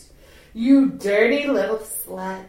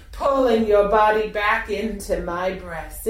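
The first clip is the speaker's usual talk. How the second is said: normal talking voice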